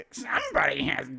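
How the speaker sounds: Pigma voice attempt. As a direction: try for the old high Pigma voice. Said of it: "I can't do it anymore".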